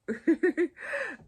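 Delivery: short high laugh; real laugh at herself. Real, not acted. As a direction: giggle at the attempt. Laughs at own pronunciation.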